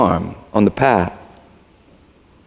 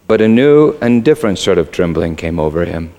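usual speaking voice

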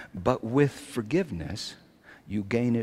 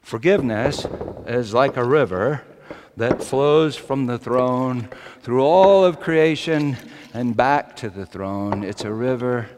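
louder, higher impassioned preacher's call; full-force preaching; 4 semitones higher, 9 dB louder than usual. Acted. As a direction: drive it home to the congregation.

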